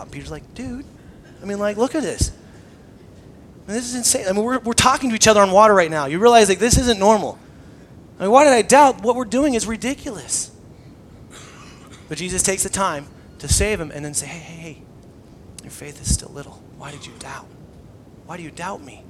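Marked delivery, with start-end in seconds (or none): 0.5-10.5 louder, higher, fast amazed ranting; incredulous Peter. Retold or imitated. Peter voiced, incredulous at walking water. 14.3-19.0 soft, hushed, partly whispered correction; calm him down as you chide; gentle rebuke. Retold or imitated. Jesus voiced gently rebuking Peter.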